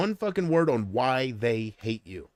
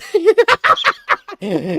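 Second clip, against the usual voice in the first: loud, high-pitched laugh; helpless high cackling laughter; real laughing fit; 10 dB louder than usual. Real, not acted. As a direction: crack up hard at your own joke. Cracks up after mock rant.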